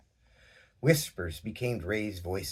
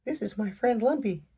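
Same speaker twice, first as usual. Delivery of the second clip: put-on little girl Pebbles; 13 semitones higher than usual. A narrator's reading. Pebbles voiced in story.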